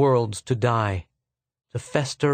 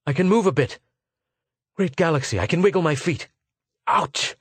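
suddenly urgent, pitched up; sudden intensity; 4 semitones higher than usual. A narrator's reading. Arviden finds he can move his feet, sudden intensity. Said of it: "with a sudden intensity".

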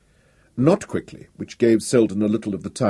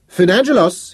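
raised, high-pitched call; 9 semitones higher, 5 dB louder than usual. A narrator's reading. Professor hailing a student, calling out. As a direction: call his name across a crowd. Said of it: "he called out".

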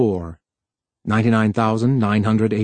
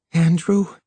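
higher, muffled questioning murmur; 6 semitones higher than usual. A narrator's reading. Noyes held tight against Harlan, muffled. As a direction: say the name into someone's shoulder, pitch lifted. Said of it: "her voice muffled by the pressure".

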